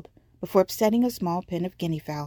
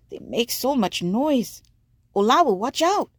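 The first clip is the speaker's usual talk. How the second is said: high, loud cry of alarm; warning shout; 6 semitones higher, 3 dB louder than usual. Acted. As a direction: yell a sudden warning. Batiko warning of the merchant.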